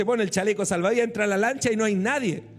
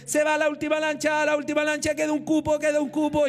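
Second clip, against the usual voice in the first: raised pitch, calling passengers to the last boat; boatman calling out; 6 semitones higher than usual. Retold or imitated. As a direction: hawk the last seats like a dock crier.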